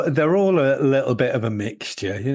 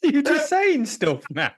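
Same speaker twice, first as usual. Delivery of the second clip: high, slow, helpless laughing speech; cracking up while talking; laughing protest; 13 semitones higher than usual. Real, not acted. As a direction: squeeze the words out through laughter. Helpless laughter at nonsense nicknames.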